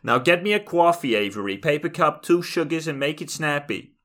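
high, hammed-up demanding mom; bossy New York mother. Mother ordering Avery to fetch coffee, high bossy nag. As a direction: order your kid around, mock-shrill. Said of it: "maybe the mother is worried".

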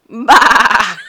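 a jerky imitation of Bah; mocking Northern English Bah; mock accent. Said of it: "kinda like a jerk".

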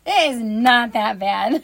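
loud, slow mock insistence; exasperated mom. Mom retelling her answer to a complaining son, exasperated. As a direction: stretch each word out, overruling the complaint.

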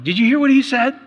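high, loud mock-outraged question; indignant husband quoting counselor. Husband fighting with wife after counseling, indignant. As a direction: throw it at your wife, voice climbing. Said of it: "'cause I was going".

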